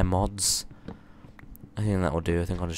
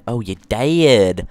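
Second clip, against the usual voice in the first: loud; 3 semitones higher, 10 dB louder, slower than usual. Shown as a demonstration.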